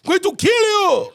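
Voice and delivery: high, loud, furious mock Afghan shout; mock Afghan accent. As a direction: yell threats in a put-on Afghan accent. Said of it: "The Afghan was like".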